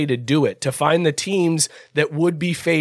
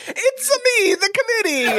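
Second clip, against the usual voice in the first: mock mobster. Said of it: "He's like".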